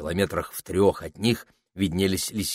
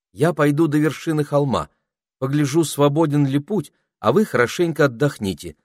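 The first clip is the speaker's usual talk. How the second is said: put-on girl's voice; 6 semitones higher, 3 dB louder than usual. A narrator's reading. Penelope voiced by narrator.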